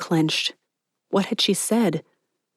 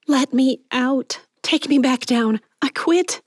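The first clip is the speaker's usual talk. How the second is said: high, frightened demands, rising at the end; mock terror; 7 semitones higher than usual. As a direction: act scared enough to quit. Clarice feigns terror to leave.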